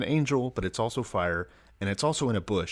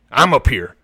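homeless guy; 4 semitones higher, 13 dB louder than usual. Retold or imitated. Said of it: "a homeless guy just goes".

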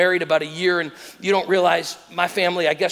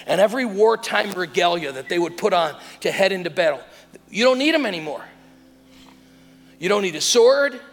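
raised, punched lines with long pauses; forceful preaching; 4 semitones higher, 5 dB louder than usual. Real, not acted. Preacher telling congregation the battle is over, forceful proclamation. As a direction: drive each short declaration home to the congregation.

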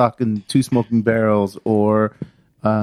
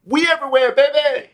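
put-on country accent; 14 semitones higher than usual. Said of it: "you wanted me to do the country accent".

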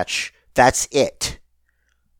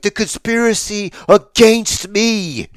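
put-on higher, slow Canadian accent leaning on "against"; Sami Zayn's Canadian accent; 8 semitones higher, slower than usual. Retelling a wrestler's promo line, hammed-up Canadian accent. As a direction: draw out the Canadian accent, a-GAINST me. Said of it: "He goes full, full Canadian".

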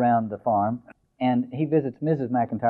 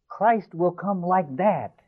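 high, slow, joyful proclamation; 7 semitones higher, much slower than usual. Acted. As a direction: announce it with delighted wonder.

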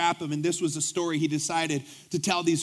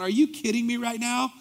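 disbelief; 6 semitones higher than usual. Retold or imitated. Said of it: "I'm just literally going".

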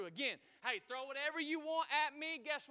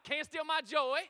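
loud rallying call to the crowd; shout it; 3 semitones higher, 8 dB louder than usual. Shown as a demonstration. Pastor leads the church in shouting the series title, loudly. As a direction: belt it out for the congregation. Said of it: "Somebody shout it".

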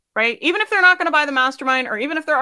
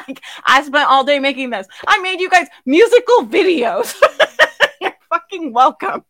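laughing through the words, higher and louder; 3 semitones higher, 4 dB louder than usual. Real, not acted. Speaker boasting to her community while laughing.

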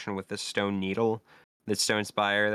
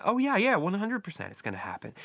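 high, quick burst of mock certainty; eager assurance; 10 semitones higher, faster than usual. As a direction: jump up high, then rattle off the reassurance. Acted believer reassures doubtful friend.

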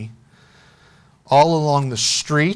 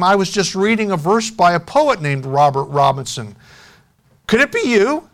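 raised, eager question lifting at the end; higher voice of a curious woman; eager curiosity; 5 semitones higher, 4 dB louder than usual. A narrator's reading. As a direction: ask with rising, hopeful curiosity.

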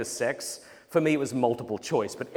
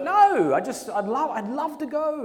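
eager pleading; 10 semitones higher than usual. Retold or imitated. Pleading with his mum.

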